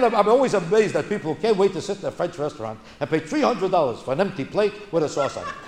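ordinary talk, nothing marked

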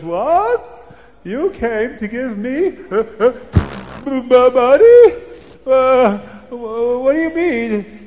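high, incredulous cries of disbelief; can't believe someone brings him money; astonished. A narrator's reading. Reb Chaim, stunned the stranger gives him money, high and incredulous.

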